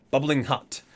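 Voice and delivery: ordinary delivery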